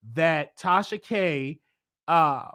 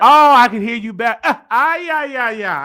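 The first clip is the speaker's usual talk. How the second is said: relieved excitement; 2 semitones higher, 9 dB louder than usual. Real, not acted. Call audio finally clear.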